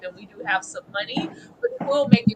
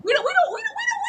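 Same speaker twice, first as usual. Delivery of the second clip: shouting plea; faster than usual. Retold or imitated.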